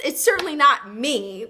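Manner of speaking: normal talking voice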